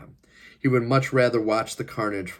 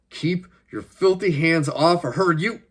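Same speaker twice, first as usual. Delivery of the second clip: slow, loud, higher furious outburst; angry protest; 9 semitones higher, 6 dB louder, slower than usual. A narrator's reading. Captive husband to guard shaking his wife, angry shout. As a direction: raise the voice to defend her.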